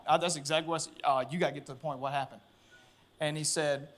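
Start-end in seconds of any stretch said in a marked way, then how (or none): 1.3-2.4 soft, low-pitched retold demand; low urgent reply. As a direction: drop the voice, quietly press for answers. Retelling tense call with officer.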